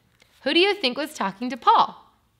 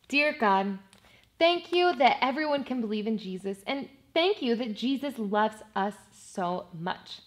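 softer, simple prayer voice; 2 semitones lower, 4 dB quieter than usual. Real, not acted. Teacher talking to God with the children, softly prayerful.

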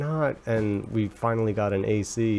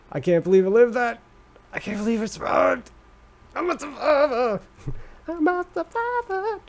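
high, giddy victory cry; real giddy triumph; triumphant relief; 13 semitones higher, 3 dB louder than usual. Real, not acted. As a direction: crow about surviving, pitched way up. Survived a skeleton attack.

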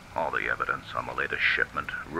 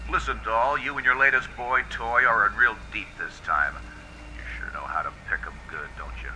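higher, wry tough-guy cop voice warning a dame; hard-boiled detective; 7 semitones higher than usual. Acted. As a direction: lean in like a cynical gumshoe, then drop to a quick mutter.

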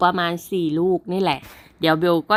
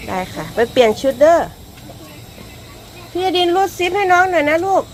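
high, louder coaxing voice; coaxing mother; 11 semitones higher, 5 dB louder than usual. Real, not acted. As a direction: gently urge them along. Coaxing kids to change.

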